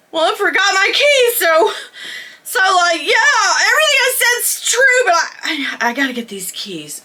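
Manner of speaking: high, whiny and sheepish; embarrassed plea. Retold or imitated. Teen coming back to the crew for her keys, high and whiny. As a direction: beg her way back in, cringing.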